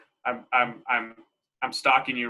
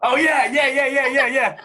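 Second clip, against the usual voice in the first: high, loud, laughing yeahs; excited laughing recognition; 10 semitones higher, 6 dB louder than usual. Real, not acted. Woman recognises her own silly filter video, laughing loudly. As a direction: burst out in delighted agreement.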